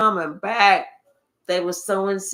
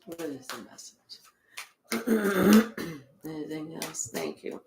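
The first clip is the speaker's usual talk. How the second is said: much softer, lower murmur to spirit; quiet aside; 6 semitones lower, 11 dB quieter than usual. Real, not acted. Reader quietly asking Spirit for the message, soft and low. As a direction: drop to a private whisper. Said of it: "aside to Spirit".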